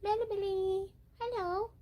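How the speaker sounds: very high, soft singsong greeting; pet-talk coo. Real, not acted. Owner greeting the cat, high cooing pet talk.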